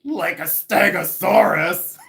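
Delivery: high, loud, slow comic punchline; imitating Judy Tenuta. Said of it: "and then she'd go".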